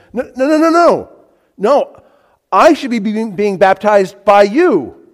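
high, loud flurry of refusals; alarmed protest. Acted. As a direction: object urgently as John the Baptist. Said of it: "Very similar to John the Baptist's response".

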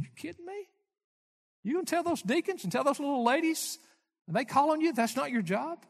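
high, disbelieving retold outburst; incredulous. Preacher reliving his shock at MacArthur's answer, high and incredulous. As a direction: voice it like you can't believe your ears.

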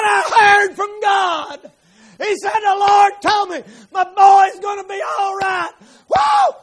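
high, loud ecstatic shout; joyful shouting. Retold or imitated. Father tells wife God answered, shouting with joy. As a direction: shout the good news to the heavens.

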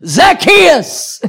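high, loud call up into a tree; playful shout. Retold or imitated. Preacher calling up to a girl in a tree, playful shout. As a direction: call out the name teasingly, big and high. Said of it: "I just shouted at her".

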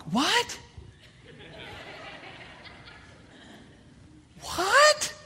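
can't believe the deer is right there; quiet shocked what, rising to a high one; stunned disbelief. Retold or imitated. Deer walks up close by.

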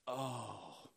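low, soft sinking "oh"; deflated groan. Retold or imitated. Peter's spirit as the rooster crows, deflated groan. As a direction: let the spirit sink, quietly.

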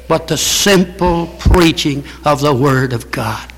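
slow, measured sermon cadence, leaning on each not; earnest pulpit preaching. Acted. Pastor preaching Luther's words to his congregation, slow and deliberate. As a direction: spell out the list like a verdict.

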